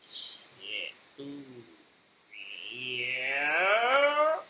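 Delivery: hushed curse sliding into a drawn-out yeah; imitated Claire reaction. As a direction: start under the breath, end on a long rising yeah. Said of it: "Claire's like".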